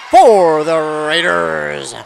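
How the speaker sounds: drawn-out, punched sportscaster call; excited point call. Real, not acted. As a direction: call the score, stretching the words out. Commentator calls a point scored.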